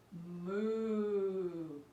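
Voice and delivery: cow moo. Shown as a demonstration. Teacher leading cow pose, low moo. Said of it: "as you say".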